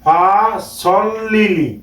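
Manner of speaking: "ফা সল লিলি" with a rounded "sol" and long vowels; correct pronunciation. Shown as a demonstration. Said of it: "আমরা শুদ্ধভাবে উচ্চারণ করব".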